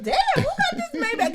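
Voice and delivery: high, amazed voice; high-pitched, wowed quote about the Maybach; impressed exclamation. Retold or imitated. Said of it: "I said".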